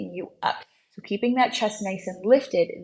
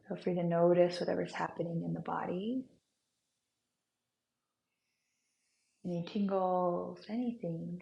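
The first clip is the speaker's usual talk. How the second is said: guided meditation; 10 dB quieter than usual. Acted. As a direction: ease them into calm. Guided breathwork, soft voice.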